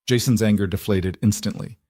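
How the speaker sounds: usual speaking voice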